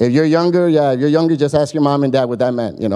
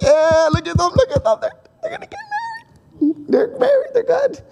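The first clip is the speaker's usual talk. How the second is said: very high, giddy squeals with a laugh breaking in; overjoyed onlookers gushing over the couple; excited crowd. Retold or imitated.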